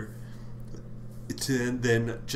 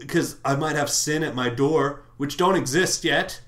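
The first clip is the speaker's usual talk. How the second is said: ranting Cain; 3 semitones higher, 4 dB louder than usual. Retold or imitated. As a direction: let Cain blow up at God. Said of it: "He says".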